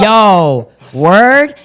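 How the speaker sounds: loud, high disbelief; shocked yo word. Retold or imitated. To the firefighter, a loud shocked disbelieving yo. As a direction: blurt it out like you can't believe it.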